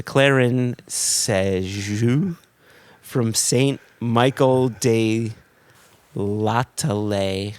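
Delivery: slow, careful attempt at French names; bad French accent. Shown as a demonstration. Rereading the rum's French name in a self-admittedly terrible accent. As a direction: sound out the French names slowly, badly. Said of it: "my French is terrible".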